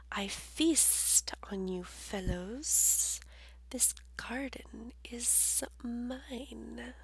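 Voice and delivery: slow, soft sly whisper; hissing snake. Acted. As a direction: hiss it slowly, menacing. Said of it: "the snake hissed".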